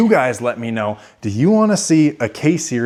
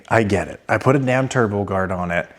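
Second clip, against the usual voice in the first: ordinary delivery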